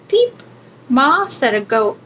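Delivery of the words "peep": very high squeaky peep; tiny chick peep. A narrator's reading. Reading to a child, the chick peeps very high. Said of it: "her chick said".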